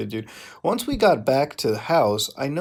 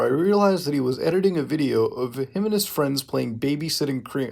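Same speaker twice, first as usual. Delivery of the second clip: ordinary delivery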